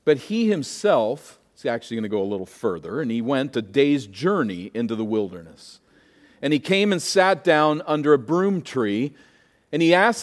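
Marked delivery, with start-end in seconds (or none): none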